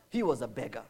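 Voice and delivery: usual speaking voice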